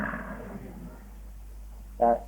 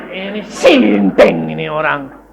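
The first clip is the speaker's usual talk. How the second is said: 1 doctor scoffing; 4 semitones lower, slower than usual. Retold or imitated. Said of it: "Dokter bilang".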